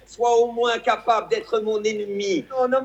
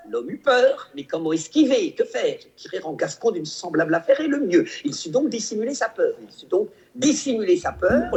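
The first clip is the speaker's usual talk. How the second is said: Fabrice Luchini impression. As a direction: declaim like a hammy stage actor. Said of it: "celui qui déclame de façon extraordinaire cette fable".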